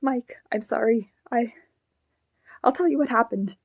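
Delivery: urgent plea. Acted. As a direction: blurt it out to stop him leaving. Puppet begs Mike to stop.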